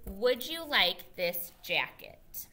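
teacher reading along slowly with the class; slow choral reading. Shown as a demonstration. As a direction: read it slowly together. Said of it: "Say the sentence with me".

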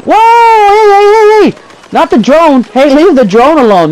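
high, loud cry of alarm; urgent, high-pitched calling out; real alarmed protest. Real, not acted. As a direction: shout them off your drone. Protests as drone is attacked.

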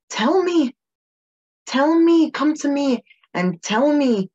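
pleading plea. Acted. Pleading to be told directly.

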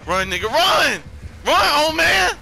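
high frantic shout; yelling run. Real, not acted. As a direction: scream at a teammate to flee. Urging teammate to flee.

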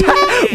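high delighted laugh; squealing giggle; burst of laughter. Real, not acted. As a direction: laugh out at the upside-down pig. Laughing at flipped pig.

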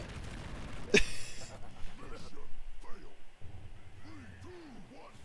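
breathless high-pitched cackle; giddy wheeze of a laugh; high giggling laugh. Real, not acted. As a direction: laugh helplessly, airy and high. Laughing after the stunt.

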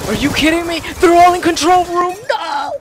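loud high furious disbelief; outraged yell. Real, not acted. Teammates all caught, outrage.

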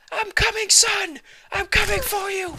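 high, loud cry of a frantic father; desperate dad call. Pretend. Father yelling for his lost son, frantic high cry. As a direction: shout after the lost son like a panicked dad.

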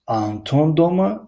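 آنتون pitched high and rising; stress on Антон. Shown as a demonstration. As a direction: lift آنتون as the question focus. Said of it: "بلند تلفظ کردن در کلمهٔ". (تأکید با آهنگ بلند روی آنتون)

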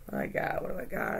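whispering to herself while searching; soft unvoiced mutter under breath; whispered muttering. Real, not acted. Rummaging through decks, muttering.

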